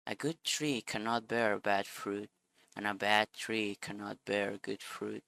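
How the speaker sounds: ordinary talk, nothing marked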